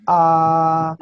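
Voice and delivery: "A" held high and level; loud, drawn-out level "A"; first tone. Shown as a demonstration. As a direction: sustain "A" on one high, unwavering note. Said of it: "un tono que va alto y sostenido".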